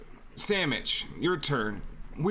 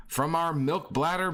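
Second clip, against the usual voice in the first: usual speaking voice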